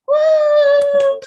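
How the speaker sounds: high loud whoop; applause whoop. Pretend. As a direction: cheer like a sound effect. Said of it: "an applause button ... just goes like".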